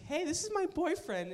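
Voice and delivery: high, perky imitation of a girl; girl showing off boyfriend. Crush introducing her boyfriend to everybody, imitated in a high voice. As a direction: chirp it high, mock-proud. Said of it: "she's showing everybody around".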